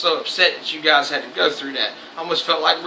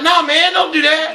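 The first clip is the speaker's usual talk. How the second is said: friend talking him down; 11 semitones higher, 3 dB louder than usual. Retold or imitated. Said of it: "He's like".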